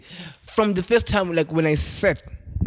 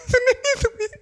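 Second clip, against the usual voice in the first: laughing. Real, not acted. Laughs while starting the twist.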